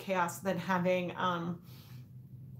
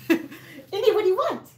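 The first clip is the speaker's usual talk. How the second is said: much higher, louder dog talk; asking the dog, higher; 10 semitones higher, 5 dB louder than usual. Acted. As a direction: pitch it way up for the dog. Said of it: "if I say".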